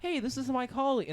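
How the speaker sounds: very high, chirpy pretend introduction; mock eager introduction. Acting out introducing fake Josh at business, mock eager. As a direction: play up the eager social greeting.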